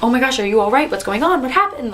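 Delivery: imitating alarmed teachers asking if she's hurt; worried coworkers. Coworkers alarmed by her scream.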